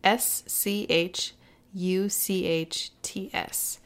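"S C H U C H T S" spelled slowly letter by letter; spelling it out. Shown as a demonstration. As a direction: read out each letter of "S C H U C H T S", slow and distinct. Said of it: "It's spelled".

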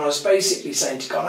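ordinary talk, nothing marked

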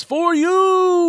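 high, loud, stretched-out yell; hammed-up cry of devotion; imitated yell. Burning cop yells to Rawhead Rex, high mock yell. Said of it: "he just yells".

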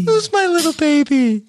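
put-on high baby voice through a laugh; laughing baby talk. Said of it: "who talks like this".

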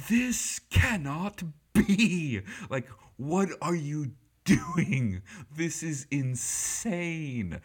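outraged British scolding, drawn out; British shouting. Retold or imitated. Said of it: "shouting himself".

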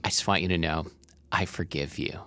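soft, leaning-in murmur; intimate murmur. Pretend. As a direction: hush it close to their ear. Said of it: "lean into someone and say".

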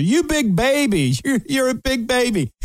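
high, teasing scolding voice; mocking spa lady. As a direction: tease him like a fussy baby. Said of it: "She goes".

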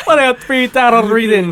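hammed-up hotline pitch through laughter; mock Jamaican accent. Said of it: "She was Miss Cleo and shit".

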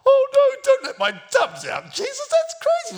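high, loud cry of alarm; frantic seller begging him to stop; panicked protest. Acted. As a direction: wail in hammed-up dismay. Acted dove seller protesting to Jesus.

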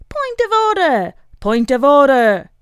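high, loud repeated yell; shouting point of order. A narrator's reading. Old man shouting to stop the quarrel, loud. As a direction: bellow it over the room.

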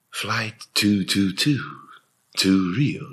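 slow, smooth airport PA voice; Iris Letielli airport announcement. Retold or imitated. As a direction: announce the flight slowly over the terminal speakers. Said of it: "que era uma voz que falava".

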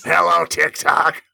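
slow raspy seductive greeting. Shown as a demonstration. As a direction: rasp it slowly to the camera. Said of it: "So let me try".

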